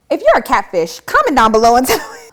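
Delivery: loud teasing call with a laugh; loud, laughing challenge to catfish viewers. Real, not acted. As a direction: dare the catfish, then laugh.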